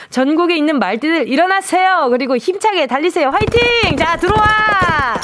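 rally the troops, ending on a high, stretched call; rousing rally cry. Acted. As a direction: play the cheerleader, voice climbing. Rallying listeners, cueing in horse.

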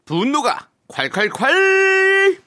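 high, slow and drawn out; hyped segment title. Acted. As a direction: shout the corner title with glee. (높고 느리게 외치는 코너 제목)

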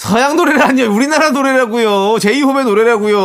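high indignant objection; indignant protest. Real, not acted. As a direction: insist it's a Korean song. Protests being called Western.

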